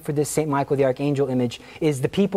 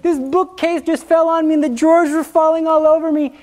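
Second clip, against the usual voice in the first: much higher, breathless excited voice; excited woman impression; 12 semitones higher, 4 dB louder than usual. Teller voicing Anne still shaken after the bookcase fell. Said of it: "impersonation of her".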